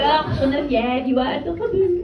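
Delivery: put-on French accent, fondly patronizing; Ness. French friend answers her naive question, French accent. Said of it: "in a French accent".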